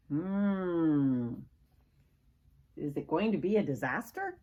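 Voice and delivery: plain reading voice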